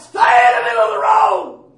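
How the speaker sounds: loud, forceful retold shout; screamed out. Prophet calling to a man choosing his road, loud shout. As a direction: call it out like an urgent warning. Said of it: "Tony screamed out".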